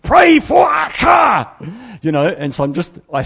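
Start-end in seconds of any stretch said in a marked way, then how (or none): 0.0-1.5 huge high slow shout; a slow, much louder and higher shouted command; booming divine voice. Retold or imitated. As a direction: boom it like a voice from heaven. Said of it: "like this voice".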